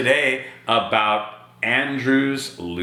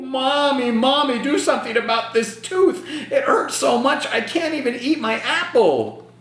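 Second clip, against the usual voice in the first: put-on much higher complaining child voice; a hammed-up kid whining about a sore tooth; whiny little boy. Andrew complaining to Mommy.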